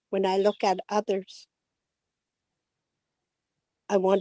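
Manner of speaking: gentle, unhurried prayer reading; calm English prayer with long pauses. Acted.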